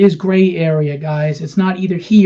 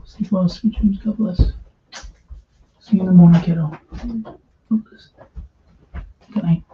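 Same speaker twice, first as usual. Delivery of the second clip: tender bedtime goodnight. Real, not acted.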